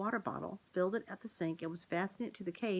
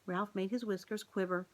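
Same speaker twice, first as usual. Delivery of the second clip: usual speaking voice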